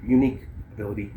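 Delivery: normal talking voice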